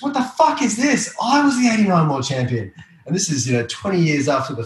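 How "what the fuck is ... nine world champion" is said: high, indignant mock outrage; imitating furious Potts. Rival surfer seeing the wrong caption, high mock fury. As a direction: play the rival spitting with disbelief. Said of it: "He goes".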